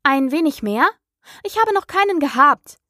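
high-pitched, mildly offended retort; somewhat touchy. A narrator's reading. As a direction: bristle slightly, pitch climbing. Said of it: "antwortete Alice etwas empfindlich".

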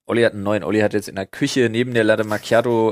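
ordinary talk, nothing marked

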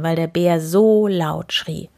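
plain reading voice

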